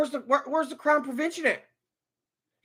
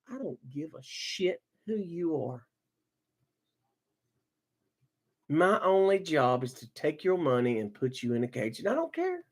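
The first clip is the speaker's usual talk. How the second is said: low, flat, uncaring cop; callous cop voice; 11 semitones lower, 9 dB quieter than usual. Retold or imitated. Mocking the arresting cop to the boat owner, low and cold. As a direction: sneer it like a cop who couldn't care less. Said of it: "this cop is like".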